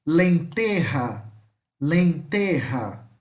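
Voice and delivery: slow "Lenterra" with an aspirated R for the J; careful Spanish model of "Lenterra"; J as R sound. Shown as a demonstration. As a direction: say "Lenterra" drawn out as len-TE-ha. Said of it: "de R novamente".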